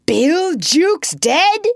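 high, loud, drawn-out disbelief; startled cry. A narrator's reading. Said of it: "cried the startled pirates".

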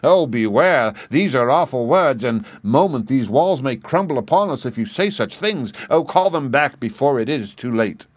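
loud, high, panicked pleading; the terrified boy begs his master to take back the curse; frightened. Acted. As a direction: beg him to take it back before doom falls. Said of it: "he was like to go out of his mind with fright".

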